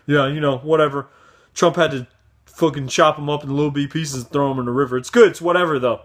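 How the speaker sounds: mock supporter shrug. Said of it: "They'd be like".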